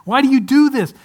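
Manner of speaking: a weary sigh that boils over into nagging; wife exasperated with her husband, sigh then high loud scolding. Retold or imitated. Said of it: "My wife is shaking her head all the time back there going".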